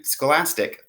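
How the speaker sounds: usual speaking voice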